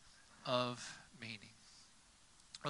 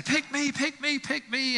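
high, begging little voice; pleading sneakers in a high voice; eager shoes; 9 semitones higher than usual. Pretend. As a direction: plead to be chosen off the shelf. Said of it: "the shoes are saying".

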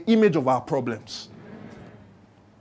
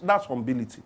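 ordinary talk

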